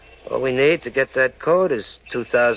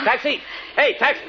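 shouting for a cab; calling out; 14 semitones higher than usual. Acted. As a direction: call a taxi across the street. Hailing a cab in the rain.

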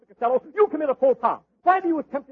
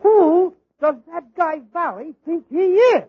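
indignant outburst; 3 semitones higher, 7 dB louder, slower than usual. Acted. [name] indignant about Valley.

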